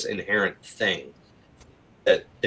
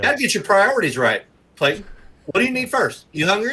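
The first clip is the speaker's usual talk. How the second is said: much higher, hammed-up salesman voice; mock billboard pitchman; 9 semitones higher, faster than usual. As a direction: pitch it like a cheesy roadside sign come to life. Mocking roadside billboard pitchman voice.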